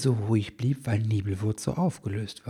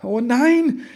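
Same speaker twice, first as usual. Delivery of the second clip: much higher and louder anguished cry; sighing again, louder; 14 semitones higher, 11 dB louder than usual. A narrator's reading. Elf man sees the phone and cries out in dismay. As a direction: wail it out in dismay. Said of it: "seufzte er noch einmal".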